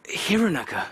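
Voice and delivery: put-on timid whispered Sri Lankan accent; shy boy whispering his name, Sri Lankan accent. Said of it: "replies timidly in a thick Sri Lankan accent".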